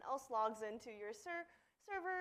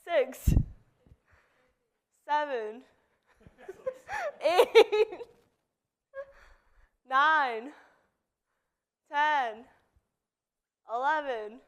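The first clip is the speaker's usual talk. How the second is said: counting workout reps; 2 semitones higher, 6 dB louder than usual. Real, not acted. Counts push-ups aloud.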